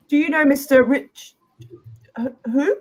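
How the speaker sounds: flustered, high startled stammer; startled clerk stammering. Acted. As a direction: caught off guard by the name. Flustered clerk at mention of name.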